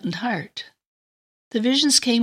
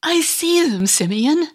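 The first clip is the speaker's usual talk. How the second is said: higher and louder with wonder; rapturous; 9 semitones higher, 5 dB louder than usual. Acted. Anna tells Simeon of her vision, rising in rapture. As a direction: burst out in ecstatic awe.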